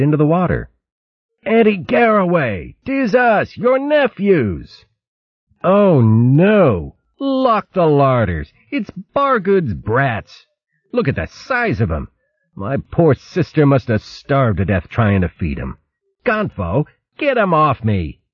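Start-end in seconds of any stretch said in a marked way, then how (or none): 1.4-4.8 high, joyful calling out; delighted yell. Acted. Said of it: "Yelling with delight". 5.6-18.2 mock horror. Aunt mock-horrified.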